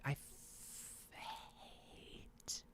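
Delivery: whispered frustration under the breath; a fed-up whisper. Real, not acted. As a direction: hiss it to yourself in annoyance. Frustrated with Facebook interface.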